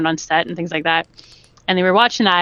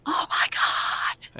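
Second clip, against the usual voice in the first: drawn-out, hushed fangirl squeal; starstruck gasp; 7 dB quieter, much slower than usual. Retold or imitated. Starstruck guest recalls meeting the cast, hushed awe. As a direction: stretch it out in breathless awe.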